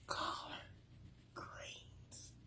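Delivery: breathy whisper of collard greens. Real, not acted. Savoring the dish name in a whisper. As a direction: whisper it reverently.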